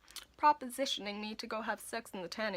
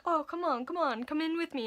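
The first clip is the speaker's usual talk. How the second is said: coaxing; 7 semitones higher than usual. Retold or imitated. Customer coaxing her to join.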